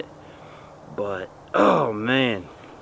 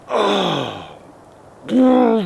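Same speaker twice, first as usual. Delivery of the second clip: high, loud burst of awe; excited exclamation; 10 semitones higher, 7 dB louder than usual. Real, not acted. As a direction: gasp it out in amazement. Awed on reaching the buck.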